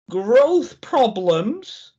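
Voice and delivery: slow emphatic. Acted. Mock dramatic repeat of symptom.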